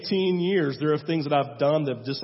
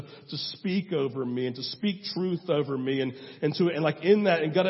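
ordinary talk, nothing marked

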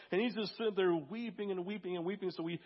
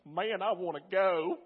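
put-on drawn-out Kentucky accent, eager; 4 dB louder, slower than usual. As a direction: imitate an eager guy from Kentucky. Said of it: "he's from Kentucky".

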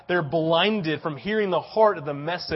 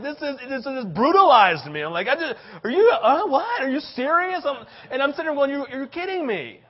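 mock disbelief; 10 semitones higher, 6 dB louder than usual. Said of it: "I'm just like".

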